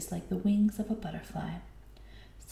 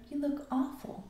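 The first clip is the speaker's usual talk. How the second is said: higher-pitched, mildly acted exclamation; mild cry of dismay; 7 semitones higher than usual. A narrator's reading. Mother greeting her returning child, mild cry of dismay. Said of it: "cried Mama Hyena".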